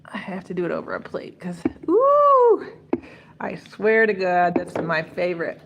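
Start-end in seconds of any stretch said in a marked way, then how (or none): 1.9-2.7 whispered ooh. Real, not acted. Reacts to the sauce dripping.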